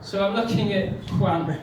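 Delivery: ordinary talk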